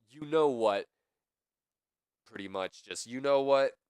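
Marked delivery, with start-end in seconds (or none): 0.1-0.9 loud, raised-pitch mock challenge; confrontational outburst. Wrestler answering his tag partner in the ring, loud challenge. Acting out Triple H confronting Austin. 3.1-3.8 much louder, higher mock challenge; confrontational outburst. Wrestler blaming his partner for a lost title, loud challenge. Acting out Triple H confronting Austin.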